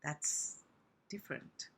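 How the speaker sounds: "different" breathed, almost silent; voiceless demo. Shown as a demonstration. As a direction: drop to a breathy, very quiet whisper. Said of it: "when I'm doing the voiceless one".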